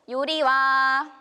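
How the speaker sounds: drawn-out loud reveal of the name; grand reveal. Acted. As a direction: announce it like a big prize. Product name big reveal.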